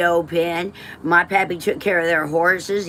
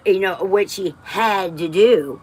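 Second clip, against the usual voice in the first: ordinary talk, nothing marked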